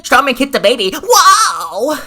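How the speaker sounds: high, loud mock outrage; sarcastic gasping exposé voice; mocking the rival. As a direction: ham it up like a clickbait YouTuber. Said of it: "Chris Norland comes on and says".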